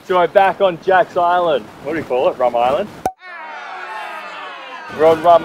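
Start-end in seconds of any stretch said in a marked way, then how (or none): none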